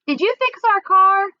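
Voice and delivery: loud, high call across distance; girl calling after Mookie riding away. A narrator's reading. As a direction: shout after him as he leaves. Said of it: "I called after him".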